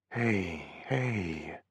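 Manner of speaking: soft, gentle hush; calm her down softly; soothing. A narrator's reading. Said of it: "I soothed".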